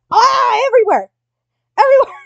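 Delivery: loud, high exasperated cry; frantic shout. Acted. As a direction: howl it like a boss at the end of his rope. Boss character ranting about flowers.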